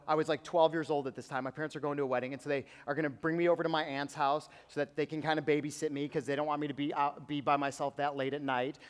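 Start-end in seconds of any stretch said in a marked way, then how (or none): none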